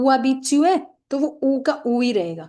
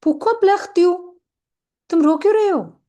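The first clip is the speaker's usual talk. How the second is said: raised, louder worried question; Paul asking; 8 semitones higher, 6 dB louder than usual. Acted. Paul asks a crying friend why, in a raised concerned voice. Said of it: "Paul is asking".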